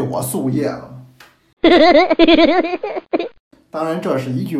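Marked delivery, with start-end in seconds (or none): 1.6-3.3 high, loud burst of laughter; hearty laugh. Real, not acted. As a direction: laugh at your own joke. Laughing at own tea joke.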